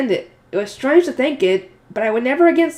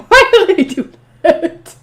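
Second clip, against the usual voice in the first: high, slow, incredulous question; laughing disbelief at him; amused disbelief; 7 semitones higher, 7 dB louder, slower than usual. Real, not acted. Reader reacting to cape flourish.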